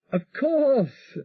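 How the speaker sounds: gentle apology to her love; voiced Elizabeth, tender. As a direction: Elizabeth greets her husband over the radio, tender. Elizabeth reassuring.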